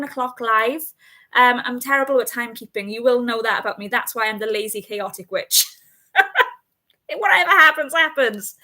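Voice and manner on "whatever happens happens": higher and louder, laughing as she says it. Real, not acted. Host jokes about her bad timekeeping, laughing. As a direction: shrug it off with a laugh. Laughs at being chaotic witch.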